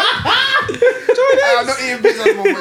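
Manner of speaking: high, slow words while laughing. Real, not acted. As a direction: speak through giggles. Laughing along.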